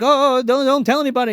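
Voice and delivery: high, loud mock begging; acted urgent appeal; frantic plea. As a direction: imitate someone panicking at being found out. Corky pleads after being scolded.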